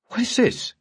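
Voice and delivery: sharp, high startled question; a woman startled at the table; surprised. Acted. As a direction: caught off guard, demanding. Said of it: "surprise clearly showing on her face".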